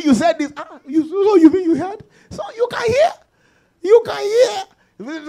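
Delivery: high, laughing teasing voice; mocking imitation. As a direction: tease them in a high voice, stretching the end. Acts out teasing exchange about hearing.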